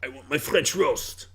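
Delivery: slow, hammed-up French accent; mock French accent. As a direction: whine for coffee in a French accent. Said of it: "French accents literally don't sound like that".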